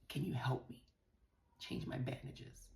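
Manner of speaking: near-whispered, slow request; hushed, hesitant plea; soft timid request. Retold or imitated. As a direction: ask softly for help, almost under the breath. Acts out asking reluctant husband for help.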